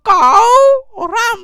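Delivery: কও রাম with a very long, loud, high "kooo"; exaggerated drill pronunciation. Shown as a demonstration. Teacher drilling the class, কও রাম stretched into a loud high call. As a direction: hold কও high and loud, then drop onto রাম. Shouting out mnemonic word for class.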